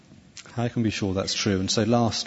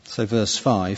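ordinary talk

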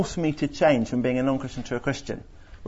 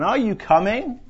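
high, raised call; calling out again; 8 semitones higher, 4 dB louder than usual. Retold or imitated. Father calling again to his daughters, high and raised. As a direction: call once more down the house. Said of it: "he called out again".

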